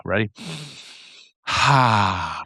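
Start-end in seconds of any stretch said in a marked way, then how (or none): 1.5-2.4 long low sighing "ha"; slow demonstration exhale, falling; breathed-out ha. Shown as a demonstration. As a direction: let the breath out on a ha. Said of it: "let out a ha".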